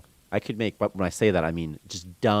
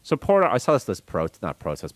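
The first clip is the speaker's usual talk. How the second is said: usual speaking voice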